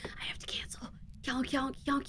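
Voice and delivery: whispering like her voice is gone; lost voice whisper. Retold or imitated. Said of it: "you lose your voice".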